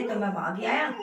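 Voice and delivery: ordinary delivery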